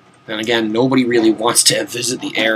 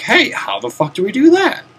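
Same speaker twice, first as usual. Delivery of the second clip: confused player; 9 semitones higher than usual. Retold or imitated. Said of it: "and be like".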